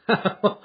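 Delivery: a burst of hearty, high laughter. Acted. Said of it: "He burst into a hearty laugh".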